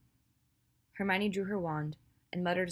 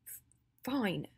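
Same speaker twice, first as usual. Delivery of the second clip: reluctant, higher, stumbling concession; forced voice; 4 semitones higher than usual. A narrator's reading. Ginny surrenders to her friend, in a forced voice. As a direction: stumble on the start, then force it out. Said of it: "she said in a forced voice".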